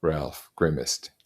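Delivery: ordinary delivery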